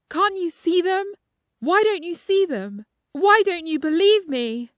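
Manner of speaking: high, loud frantic yelling; a patient screaming that the hospital will burn; panicked shouting. Acted. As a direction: shout it in terror at the staff. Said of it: "the shouting man".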